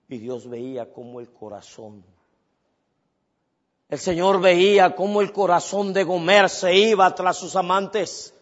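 ordinary talk

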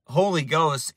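ordinary delivery

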